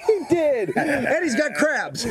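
high, drawn-out joke reveal; laughing mock announcement. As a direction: play along while cracking up. Joining the mock emcee bit, laughing.

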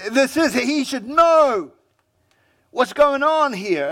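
a self-righteous guest grumbling; indignant Pharisee. Retold or imitated. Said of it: "they're looking and going".

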